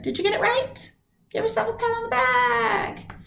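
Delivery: high, bright cheering praise; cheerful praise. Real, not acted. Teacher cheering students who got it right. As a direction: cheer the class on.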